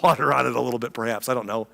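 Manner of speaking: usual speaking voice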